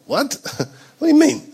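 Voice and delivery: high, baffled squeak of disbelief; incredulous onlooker. Acted. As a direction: laugh it out in mock bewilderment. Acting baffled onlookers, high pitch.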